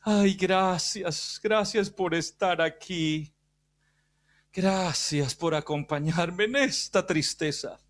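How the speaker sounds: milk the sympathy, weak and slow; imagined self-pitying Jesus. Retold or imitated. Preacher voicing a wounded Jesus thanking the weeping women, feeble and slow. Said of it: "Yo me imagino la cara".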